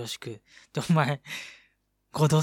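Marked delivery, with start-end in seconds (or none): 2.1-2.4 loud accusing call. Shown as a demonstration. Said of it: "合同セルフトーク忘れてんだろ。".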